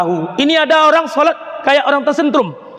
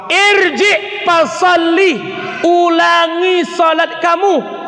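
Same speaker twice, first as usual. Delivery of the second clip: higher, slow commanding voice; stern Prophet; 6 semitones higher, slower than usual. Retold or imitated. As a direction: order him firmly to pray again. Prophet orders man to repeat.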